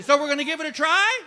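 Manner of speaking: high, loud bubbly nurse; mock bubbly nurse, high and loud. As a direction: chirp it like an overly cheerful nurse. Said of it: "Christy comes in all bubbly".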